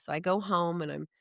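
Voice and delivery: normal talking voice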